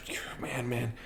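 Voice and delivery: whispered in awe; awed whisper. Real, not acted. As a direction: breathe it out in disbelief. Awed at the fighter's move.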